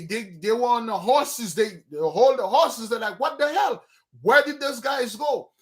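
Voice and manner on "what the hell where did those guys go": high, startled disbelief; baffled outcry. Retold or imitated. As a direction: cry out as if the men just vanished. Bandits baffled, men vanished.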